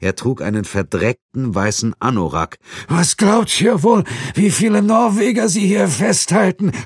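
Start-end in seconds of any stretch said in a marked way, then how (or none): 2.9-6.7 desperate shout. Acted. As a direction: scream it in desperation. Tortured prisoner cries out. (verzweifelter Schrei, hoch und langsam)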